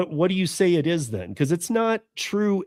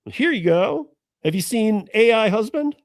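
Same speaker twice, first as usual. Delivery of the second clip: high, sing-song salesman's patter; mock sales pitch; 8 semitones higher than usual. Selling an AI husband to a widow, mock sales pitch. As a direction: pitch it like a hammed-up huckster. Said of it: "selling this shit".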